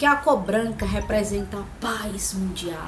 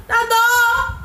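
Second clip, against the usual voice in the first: exclaiming; 10 semitones higher, 5 dB louder than usual. Real, not acted. Exaggerated pity about belly.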